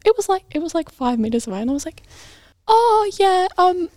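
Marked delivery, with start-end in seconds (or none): 2.7-3.9 high-pitched, hesitant customer-service voice; awkwardly polite refusal. Retold or imitated. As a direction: let a customer down gingerly, voice up high. Nervous staffer refusing demanding mum.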